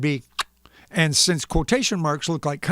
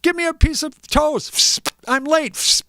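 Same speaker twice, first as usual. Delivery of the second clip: put-on higher, louder hurried kid shout; higher, louder rushed kids' yell; 11 semitones higher, 5 dB louder, slower than usual. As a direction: yell it like a late child. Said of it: "kids came running and yelling".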